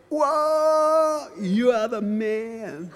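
drawn-out stunned gratitude; amazed son. Retold or imitated. Son Joe sees his new surfboard, slow awed praise.

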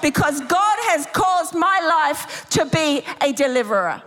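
high, emphatic preaching with conviction; full-force preaching. Real, not acted. Preacher declaring deliverance over the congregation, high and emphatic. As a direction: declare it over them with authority.

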